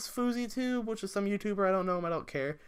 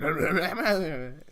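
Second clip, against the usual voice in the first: imitated laugh; 3 dB louder than usual. Mocking characters laughing for minutes.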